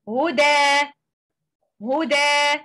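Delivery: هدى ended on a long two-count aa, tanween dropped; stopping with alif. Shown as a demonstration. Teacher showing the stop on هدى, vowel held for two counts. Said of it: "lengthening مد with two count, two vowel count".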